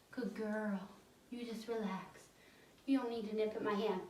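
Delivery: calm her down softly; soothing praise. Real, not acted. Trainer soothing puppy after correction, soft and gentle.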